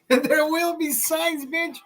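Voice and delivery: slow, high-pitched mock voice; goofy high echo of your own line; playful high repeat. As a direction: squeal it out, high and drawn-out.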